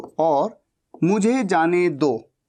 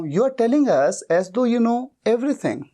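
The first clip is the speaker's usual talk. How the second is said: slow dictation; 3 semitones higher, much slower than usual. Shown as a demonstration. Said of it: "as though भी कह सकता हूं".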